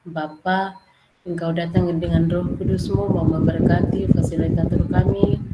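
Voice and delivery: praying. Acted. Leads group prayer.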